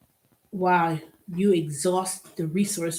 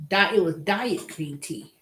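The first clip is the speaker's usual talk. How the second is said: ordinary talk, nothing marked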